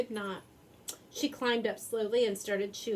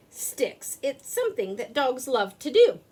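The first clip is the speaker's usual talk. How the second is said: usual speaking voice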